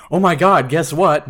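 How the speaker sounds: high, loud mock-excited outburst; excited news-breaking. Patient imagines waking a roommate with news, in acted excitement. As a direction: burst out as if you can't wait to tell.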